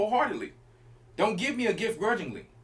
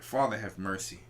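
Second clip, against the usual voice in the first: low, weary pleading to the Lord; a tempted man begging, voice dropped low; man worn down by temptation, low, pleading; 9 semitones lower, 6 dB quieter than usual. Retold or imitated. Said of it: "He like".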